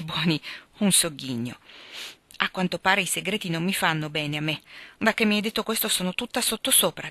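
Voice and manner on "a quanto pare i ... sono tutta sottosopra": plain reading voice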